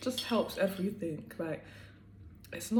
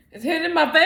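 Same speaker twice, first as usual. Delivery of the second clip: high, loud burst of energy; energized; 9 semitones higher, 16 dB louder, faster than usual. Real, not acted. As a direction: shout it like a caffeine rush. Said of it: "I feel the energy".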